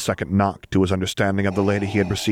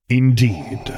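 easy confirmation; 4 semitones higher, 5 dB louder than usual. A narrator's reading. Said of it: "Vader confirmed easily".

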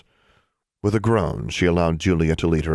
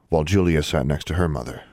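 plain reading voice

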